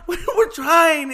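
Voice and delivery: high, loud, drawn-out mock protest; exasperated son yelling. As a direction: yell it back slowly at your mother. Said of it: "Giuseppe turns around".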